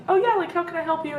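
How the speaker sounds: quick, high customer-service voice; retold hasty, high-pitched helpfulness; covering with cheer. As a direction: pretend all is well and offer help. Putting on cheer while holding back tears.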